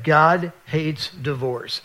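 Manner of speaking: slow, weighty words; solemn reading. Retold or imitated. Reading what his wife underlined, slow and solemn. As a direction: let each word land slowly.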